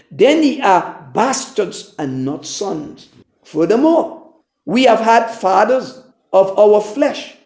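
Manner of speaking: measured, solemn Bible reading; formal King James scripture reading. Acted. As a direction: read the verses like holy writ, steady and deliberate. Reads scripture aloud before preaching.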